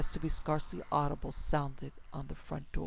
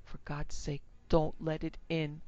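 ordinary delivery

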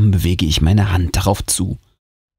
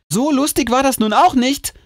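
annoyed. Acted. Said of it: "sage ich genervt".

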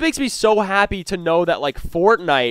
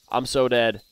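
ordinary delivery

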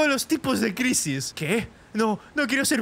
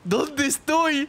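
laughing; 5 semitones higher, much slower than usual. Real, not acted. Laughing as character.

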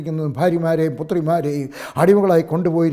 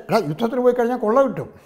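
higher, quick mock voice of a greedy man; greedy inner thought; 6 semitones higher, faster than usual. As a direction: toss it off like a tempted fool. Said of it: "നീ വിചാരിച്ച".

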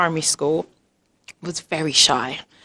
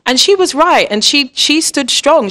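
high, loud proud emphasis; 9 semitones higher, 6 dB louder than usual. Real, not acted. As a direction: burst with pride. Proud of daughter's stand.

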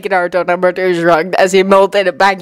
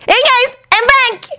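put-on high-pitched eager boy; high boyish call; announcing. A narrator's reading. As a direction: announce your return in a high kid voice. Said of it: "Naruto announced".

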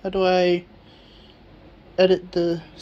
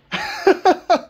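high burst of giddy laughter; 4 dB louder than usual. Real, not acted. Apologizing to viewers for being loud, giddy laugh. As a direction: laugh it off, overwhelmed with joy. Laughing after fixing board.

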